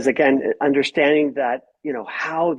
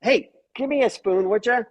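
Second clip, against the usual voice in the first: brusque request; 6 semitones higher than usual. Shown as a demonstration. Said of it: "If it's".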